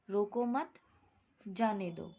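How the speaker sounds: रोको मत joined and rising, long break, falling जाने दो; pause after मत. Shown as a demonstration. Teacher reading the second example to class, pausing after मत. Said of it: "यहाँ मत के बाद".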